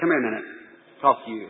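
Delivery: low, soft retold voice; quiet fatherly summons. Father calling his son over for a talk, quietly serious. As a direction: drop low and quiet to call the boy aside.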